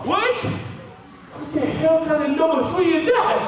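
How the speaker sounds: high-pitched, drawn-out mock outrage; outraged disbelief. Patient hearing the worst outcome, hammed-up high disbelief.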